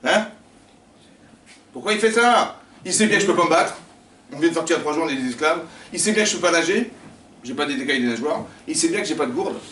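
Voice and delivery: high, loud protest at God; a trapped slave protesting loudly; indignant complaint. Acted. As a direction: rail against being trapped. Acting the trapped Israelite complaining.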